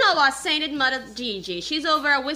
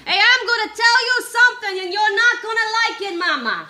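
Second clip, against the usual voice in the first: higher and louder, squaring up to mama; mock defiant announcement; 7 semitones higher, 4 dB louder than usual. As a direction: announce it like a dare. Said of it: "So, so I say".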